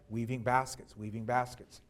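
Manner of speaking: usual speaking voice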